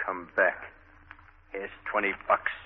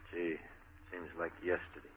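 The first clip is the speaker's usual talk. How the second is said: soft, nostalgic sigh; wistful; 2 semitones lower, 13 dB quieter than usual. Acted. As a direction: let the memory ache quietly. Wistful memories of childhood.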